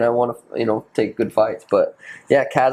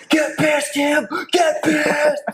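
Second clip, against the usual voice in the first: put-on drunk dad yelling; 14 semitones higher, much slower than usual. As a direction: bellow at Tim like a drunk dad in the crowd. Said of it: "Dad was drunk and he was".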